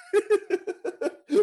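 a burst of high giddy laughter; high delighted laugh. Acted. Costard delights in the word.

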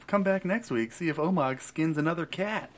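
mock eighties TV sign-off; sitcom promo announcer. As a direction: sell the next episode, hammed-up. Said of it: "like, an eighties sitcom now".

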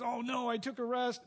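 put-on flustered wake-up; mock panic. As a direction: pretend to wake in a mild panic. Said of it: "wakes up all panicked and he says".

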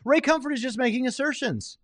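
high, mocking whiny objection; mocking skeptic voice. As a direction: mimic the skeptic's high scoff. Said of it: "Now you say".

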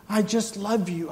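soaring declaration of love; fervent. Acted. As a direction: lift it up with passion. Building God's words.

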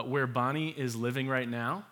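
ordinary talk, nothing marked

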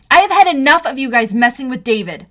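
enraged confrontation; blurted in anger. Acted. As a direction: burst out at the bullies. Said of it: "I blurted".

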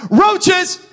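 sudden loud high answer; blurted shout. Retold or imitated. Pastor blurting his fear to a questioner, loud shout. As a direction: shout the word like a reflex.

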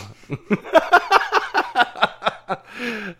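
long high burst of laughter. Real, not acted. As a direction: laugh hard and long, then trail off. Long laugh after the joke.

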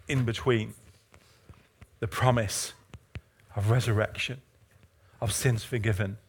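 low, halting, hushed scripture delivery; solemn preaching. Real, not acted. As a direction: drop low and soft, phrase by phrase.

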